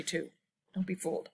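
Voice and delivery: usual speaking voice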